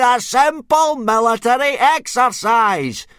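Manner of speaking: mock the heir in front of everyone; teacher ranting at Hiccup before the boys; sarcastic tirade. A narrator's reading.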